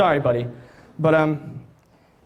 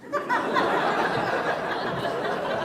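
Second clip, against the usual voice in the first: laughs; 4 dB quieter than usual. Real, not acted. Laughing at own joke.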